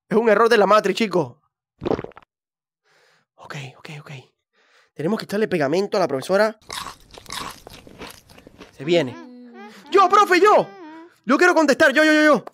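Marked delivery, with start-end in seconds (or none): 3.3-4.1 hushed whisper. Real, not acted. Player hiding from the teacher, whispering. 9.9-12.4 high eager calling out; eager student. Acted. Student begging the teacher to answer, high eager call.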